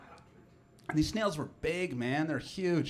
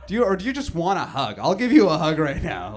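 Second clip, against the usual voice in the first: loud and high, laughing in disbelief; mock-outraged yell climbing to a hug offer; incredulous shout; 5 semitones higher, 6 dB louder than usual. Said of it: "I'm like".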